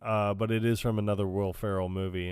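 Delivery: ordinary talk, nothing marked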